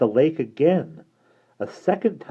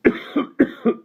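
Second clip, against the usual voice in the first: a real cough from a hurt throat; strained cough; 4 dB louder than usual. Real, not acted. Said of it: "Mudlik does my voice in".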